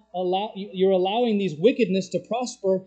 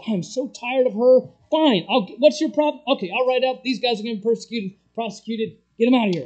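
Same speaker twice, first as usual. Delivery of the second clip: exasperated judge; 4 semitones higher, 5 dB louder, faster than usual. Retold or imitated.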